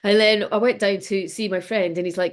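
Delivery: ordinary delivery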